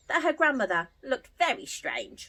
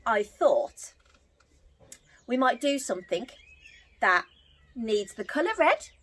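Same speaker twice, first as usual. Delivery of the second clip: usual speaking voice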